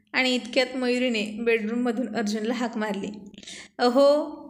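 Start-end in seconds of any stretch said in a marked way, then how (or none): 3.8-4.4 calls out loud to the next room; calling out. A narrator's reading. Wife calls husband from the bedroom, loud call. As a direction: raise the voice to reach another room.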